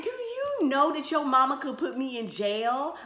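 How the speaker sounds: playful scolding; mock outrage. Girlfriend scolding younger boyfriend, high mock outrage. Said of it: "I said".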